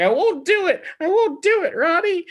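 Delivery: high, loud mock hysteria; over-the-top refusal. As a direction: squeal it in hammed-up melodrama. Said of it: "you were acting at an eleven".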